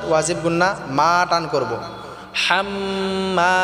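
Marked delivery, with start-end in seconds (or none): 2.4-3.5 হাম্মা with a pressed throat ha and nasal doubled m. Shown as a demonstration. Teacher showing the heavy ha to students, slow chant. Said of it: "গলায় চাপ দিয়ে উচ্চারণ করব".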